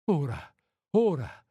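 acted, mild sob without real crying; sobbed retort. A narrator's reading. Woman to the lover who is leaving, lightly sobbed protest. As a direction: protest with a touch of a sob, rising on the repeat. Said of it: "singhiozzò ella".

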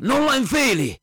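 high, sharp alarm cry; urgent warning. Acted. The hunter warning the others off the masks, alarmed shout. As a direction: stop them before they put it on.

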